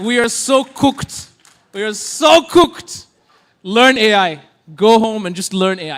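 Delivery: loud, slow comic doom warning; mock alarm. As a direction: hammer it out loudly to the crowd. Comic alarm shouted at the crowd.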